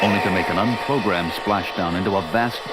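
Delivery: measured trailer narrator voice; story narration. A narrator's reading. Narrator introducing three stranded astronauts, measured and grave. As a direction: set the scene like a film trailer.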